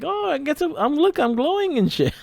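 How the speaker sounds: very high, squeaky put-on voice; hammed-up falsetto ghost. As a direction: squeak it like a giddy glowing ghost.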